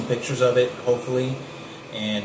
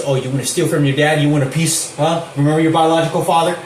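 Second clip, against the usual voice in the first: louder, higher, fast sneering jab; hammed-up imitation of a taunting man; mocking taunt; 4 semitones higher, 7 dB louder than usual. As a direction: needle him about his dad, quick and loud. Mocking taunt about his father.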